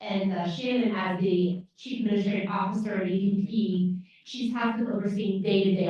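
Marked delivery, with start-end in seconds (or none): none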